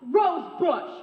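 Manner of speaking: loud furious shout of a name. Acted. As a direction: yell her name in rage. Spots rival, wants to kill her.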